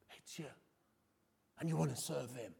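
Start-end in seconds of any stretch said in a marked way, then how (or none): 0.0-0.5 dropped almost to a breath; hushed echo. Real, not acted. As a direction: let it sink in quietly. Repeating for effect.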